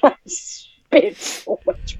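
laughing. Real, not acted. Breathless laughter after spitting water.

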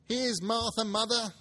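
little ones shouting with glee; children crying out. Acted. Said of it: "cried the two young Cratchits".

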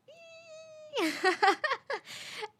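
high squealing kettle breaking into laughter; long high whistle dissolving into giggles; kettle whistle imitation. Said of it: "it's just making that loud noise".

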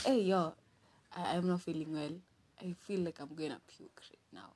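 weak, nauseous whisper, fading out; sick whisper. Retold or imitated. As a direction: breathe it like you're about to throw up. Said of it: "I was like".

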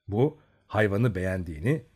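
plain reading voice

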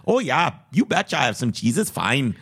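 mock Upper Midwest Canadian-style accent; thick Fargo accent. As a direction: do a hammed-up Fargo local. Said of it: "Canadian accent".

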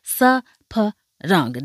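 slow, syllable-by-syllable "Saparanga"; Korean pronunciation. Shown as a demonstration. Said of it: "That's how you would say it in Korean".

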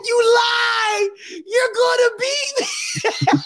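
high, wailing accusation; hammed-up kid bawling in disbelief; mock-hysterical squeal; screaming. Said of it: "I'm just screaming, yelling".

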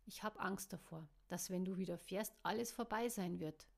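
ordinary delivery, nothing marked

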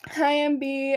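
ordinary talk

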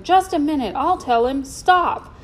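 high, loud urgent call; shouting. A narrator's reading. Man runs after the boy to stop him, shouting.

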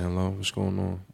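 low, soft just-woke-up voice; sleepy voice. Retold or imitated. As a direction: sound like you just woke up. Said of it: "We throw that voice on".

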